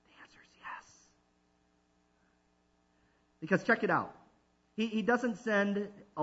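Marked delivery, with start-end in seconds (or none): 0.1-0.9 breathy stage whisper of the answer. Acted. Preacher whispering the answer to the congregation. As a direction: hush it like a secret.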